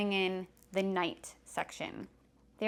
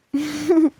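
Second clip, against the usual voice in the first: bursting out laughing; real laugh; loud laugh; 7 semitones higher, 13 dB louder than usual. Real, not acted. Laughs at the alarm.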